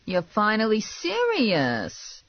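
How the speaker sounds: slow, high, drawn-out marvel; impressed. A narrator's reading. Man seeing her armed for work, impressed. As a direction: stretch the last word in admiration. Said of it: "clearly impressed".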